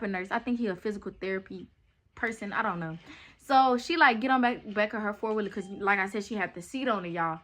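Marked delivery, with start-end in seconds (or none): none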